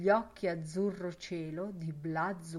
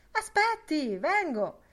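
high, louder call; calling after; 9 semitones higher, 6 dB louder than usual. Acted. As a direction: hurry after him. Lenihan chasing Boylan.

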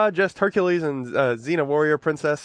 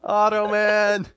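slow drawn-out; 3 semitones higher, much slower than usual. Acted.

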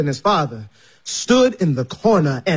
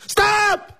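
yelled stop; 13 semitones higher than usual. Retold or imitated. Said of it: "he yelled".

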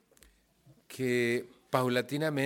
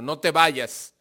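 loud, higher command yelled after someone; imitated shout; 4 semitones higher, 8 dB louder, much slower than usual. Father yelling at his son who is leaving, shouted order. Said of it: "Y me gritó".

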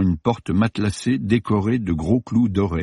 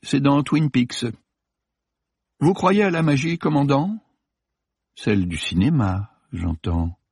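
honeyed voice; 6 semitones higher than usual. A narrator's reading. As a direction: purr the question. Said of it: "la voix doucereuse de Delacroix".

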